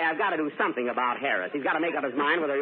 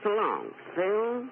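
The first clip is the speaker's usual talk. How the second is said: slow, higher sultry farewell, lightly voiced; Mae West impression; 6 semitones higher, much slower than usual. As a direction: drawl it like a brassy floozy. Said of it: "make believe it's Mae West".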